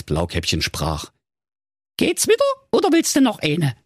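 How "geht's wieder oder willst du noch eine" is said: put-on very high, squeaky girl voice; cheeky young girl. Police girl to goat.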